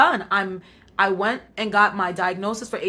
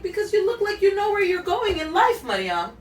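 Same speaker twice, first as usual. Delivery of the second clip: lightly voiced little sister, higher; a hint of a nine-year-old girl's voice; 10 semitones higher than usual. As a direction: kid sister telling her plainly. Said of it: "And she's like".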